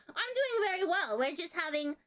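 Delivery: high streamer voice easing down; high-pitched reply. Real, not acted. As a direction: chirp the answer up high. Answers a viewer's greeting.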